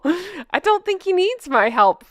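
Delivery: usual speaking voice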